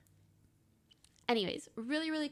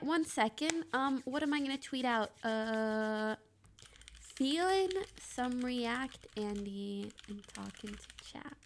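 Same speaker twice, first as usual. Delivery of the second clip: usual speaking voice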